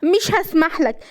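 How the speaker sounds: high-pitched, impassioned outburst; heated defiance. A narrator's reading. Wife forbidding his return to old ways, furious. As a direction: refuse him hotly, keep pushing.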